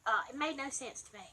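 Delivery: usual speaking voice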